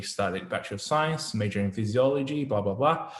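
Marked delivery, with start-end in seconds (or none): none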